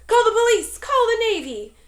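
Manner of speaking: high, loud frantic cry; panicked shriek. A narrator's reading. Frightened coach shrieks for the police and the Navy. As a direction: keep shrieking for help. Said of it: "shrieked the coach".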